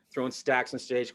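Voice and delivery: ordinary delivery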